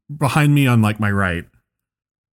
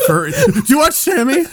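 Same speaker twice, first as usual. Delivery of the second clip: very high, squeaky mock-panic voice; panicked. Retelling a blurt to a furry stranger, squeaky panic. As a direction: voice cracks upward in hammed-up alarm. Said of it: "you say it in that panic voice".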